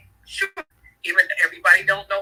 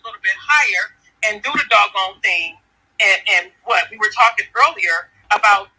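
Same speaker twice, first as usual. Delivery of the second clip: louder, rousing preacher cadence with call and response; preaching; 6 dB louder than usual. Real, not acted. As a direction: fire the listeners up like a Sunday sermon. Motivational preaching to women.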